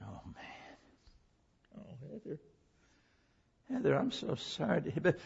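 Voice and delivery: breathless, hushed horror; hushed shock. Retold or imitated. Preacher reliving his stunned reaction to Heather's confession. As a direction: let the air go out of you.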